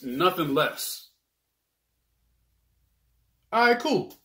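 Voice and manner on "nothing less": firm. Acted. Haggling for two plates.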